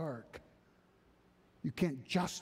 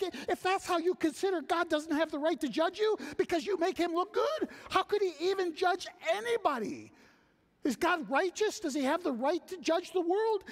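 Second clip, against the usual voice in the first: Paul's rebuttal; 3 dB louder than usual. Acted. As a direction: refuse it flatly as the apostle. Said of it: "Paul's like".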